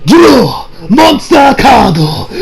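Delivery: slow, dramatic shouted duel call, imitating the clip; anime duel shout. Anime hero calling the duel to start, drawn-out shout. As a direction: belt it like an anime duelist drawing a card. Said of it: "watched the clip".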